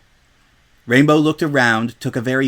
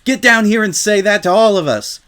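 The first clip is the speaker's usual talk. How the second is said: higher, louder taunting challenge; challenging; 9 semitones higher, 5 dB louder than usual. Acted. Crowd pony dares Rainbow to land, taunting. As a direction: dare her to come down. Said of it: "some pony else challenged".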